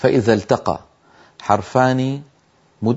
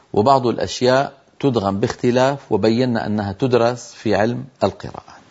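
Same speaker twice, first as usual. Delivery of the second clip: normal talking voice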